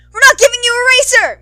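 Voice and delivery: high loud defiant yell; rebellious yell at the board. Acted. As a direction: shout it at the board. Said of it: "Muryu Togarasu yells".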